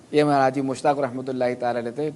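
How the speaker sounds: normal talking voice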